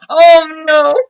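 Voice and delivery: usual speaking voice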